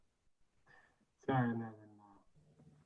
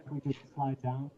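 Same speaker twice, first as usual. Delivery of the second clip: normal talking voice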